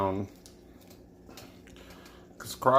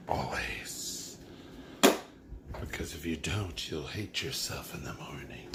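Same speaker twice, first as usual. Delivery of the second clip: whispering; 5 semitones lower, 6 dB quieter than usual. Pretend. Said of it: "repeats advice in a hushed voice".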